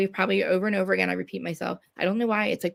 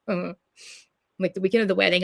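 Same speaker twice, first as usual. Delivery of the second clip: ordinary delivery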